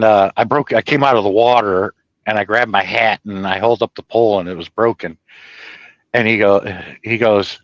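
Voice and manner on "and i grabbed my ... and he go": normal talking voice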